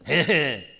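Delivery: chuckle, then a loud, high, slow answer; chuckling punchline. Retold or imitated. Principal settling the couple's fight, chuckling and loud. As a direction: tease them with the obvious answer. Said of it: "뭐라 하는지 알아요?".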